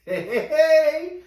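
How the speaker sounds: high, slow, drawn-out hype call; excited greeting. Real, not acted. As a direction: stretch it out like a show host. Show intro greeting to viewers.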